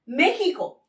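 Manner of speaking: normal talking voice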